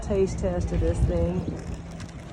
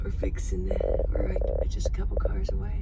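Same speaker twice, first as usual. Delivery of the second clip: whispering; 4 dB quieter than usual. Real, not acted. Sneaking up to the border booth.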